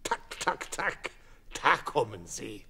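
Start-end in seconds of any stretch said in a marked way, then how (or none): 0.0-1.1 hen clucking. A narrator's reading. Hens clucking in the story.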